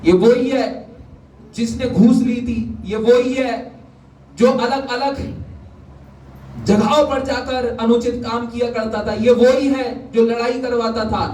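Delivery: high, emphatic listing of accusations; preaching. Real, not acted. Preacher repeats what mockers say of converts, high and emphatic.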